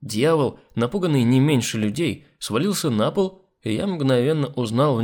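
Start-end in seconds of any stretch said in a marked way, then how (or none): none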